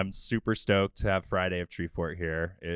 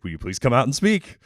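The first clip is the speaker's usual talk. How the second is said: higher, louder retold invitation; eager plea; 6 semitones higher, 7 dB louder than usual. Host retelling how he begged his customer to speak, eager plea. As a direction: plead eagerly, voice lifted.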